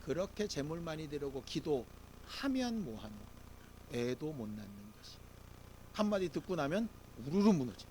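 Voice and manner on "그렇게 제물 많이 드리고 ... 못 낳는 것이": mocking rival. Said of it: "분인나가 얘기합니다".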